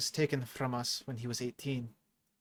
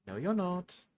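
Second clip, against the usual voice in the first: higher, lilting sing-song retort; 6 semitones higher, slower than usual. A narrator's reading. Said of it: "in a bit of a sing-song tone".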